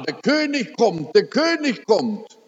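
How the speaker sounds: high, eager announcement; wife repeating the King is coming, excited. Retold or imitated. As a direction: call it out with wonder.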